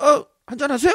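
high, loud, eager offer of a drink; eager drinking buddy. Pretend. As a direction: push the drink on them cheerfully. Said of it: "막 이러고". (신나서 높고 크게 술 권하는 후배)

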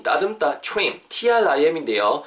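ordinary talk

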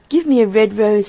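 loud but restrained plea; crying out. A narrator's reading. Nightingale asks the second tree, restrained cry. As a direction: call out the request with some force. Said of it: "she cried".

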